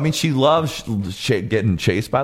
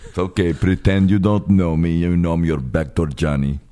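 [name]; 9 semitones lower than usual. Acted.

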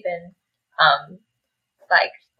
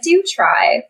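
higher-pitched, mock-formal imitation; 8 semitones higher than usual. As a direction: put on playful formality, pitched up. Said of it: "the mock formal tone".

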